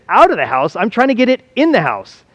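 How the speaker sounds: baffled retort, pitch jumping up; mock incredulous. Man answering his would-be helper, incredulous. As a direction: retell it as a puzzled comeback. Retold baffled reply in a joke.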